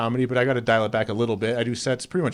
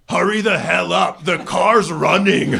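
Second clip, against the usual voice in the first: mock drunk yell; 3 semitones higher, 3 dB louder than usual. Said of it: "she turns around and yells to me".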